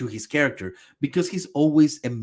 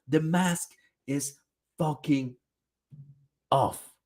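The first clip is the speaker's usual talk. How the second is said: ordinary delivery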